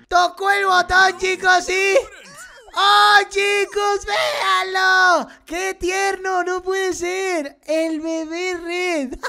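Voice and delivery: high, loud, stretched-out squeal of delight; gleeful exclamation. Real, not acted. Gamer seeing Red turned into a baby, gleeful cooing. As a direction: cry out slowly and high, melting into a laugh.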